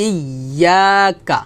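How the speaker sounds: very slow "ইয়াকা", long "ইয়া", short "কা"; recited "ইয়াকা", stretched then cut short on "কা"; palatal held ইয়া. Shown as a demonstration. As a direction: press and hold the y in "ইয়াকা". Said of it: "ইয়া উচ্চারণটা হবে".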